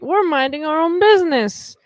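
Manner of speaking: high, loud put-on innocence; exaggerated bystander indifference; mock nonchalance. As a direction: sing out that it's none of your concern. Voicing indifferent bystanders in hallways.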